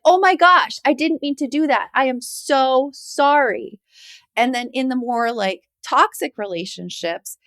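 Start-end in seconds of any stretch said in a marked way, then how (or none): 0.0-3.8 high, loud, slow sorry; apologetic husband. Retold or imitated. Husband apologizing at once, high and earnest. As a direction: gush the apology, drawn out. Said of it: "He's like".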